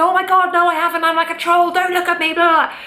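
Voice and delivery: high-pitched, flustered refusal of praise; hammed-up self-deprecating squeal; mock-horrified protest. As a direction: bat the compliment away in a high voice. Acting out a compliment being refused.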